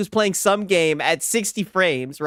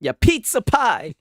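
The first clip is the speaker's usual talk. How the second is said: mock Italian; 7 semitones higher, slower than usual. Jokes he thinks he's Italian.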